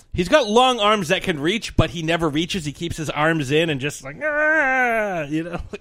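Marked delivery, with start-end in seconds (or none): 4.2-5.2 mock monster noise. Miming Gabra's pose.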